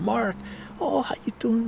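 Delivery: high, gushing hello; excited greeting. Retold or imitated. Greets his mentor in the story.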